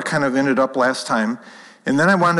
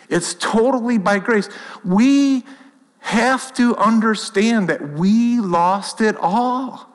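fervent preaching; 8 semitones higher, slower than usual. Real, not acted. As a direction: drive it home from the pulpit. Preaching at full force.

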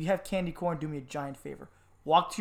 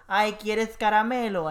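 lightly voiced candy lady, a touch higher; a hint of the chintzy neighbor at the door; stingy neighbor lady; 4 semitones higher, 4 dB louder than usual. Imitating the neighbor lady offering candy, lightly voiced. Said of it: "a Laura who's opening the door and goes".

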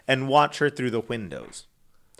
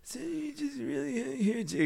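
put-on high, softer old man; put-on elderly voice; 11 semitones higher, 9 dB quieter than usual. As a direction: speak up as a comic old-timer. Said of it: "the, the voice".

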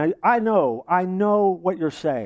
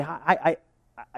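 ordinary talk

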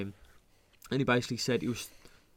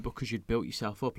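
ordinary talk, nothing marked

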